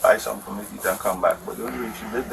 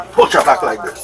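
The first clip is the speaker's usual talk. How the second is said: loud demonstration; 3 semitones higher, 13 dB louder than usual. Retold or imitated. Acting out the push.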